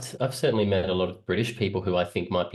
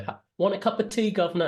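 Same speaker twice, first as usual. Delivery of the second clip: much higher, hammed-up British imitation; mock British accent; 11 semitones higher than usual. Australian quoting Americans who mocked them, mock British accent. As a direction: imitate a teasing fake Brit accent. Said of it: "doing all these British things at me to mock me".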